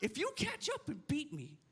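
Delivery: high-pitched mock drunk bravado; cocky taunt. Cocky drunk dad taunts kid.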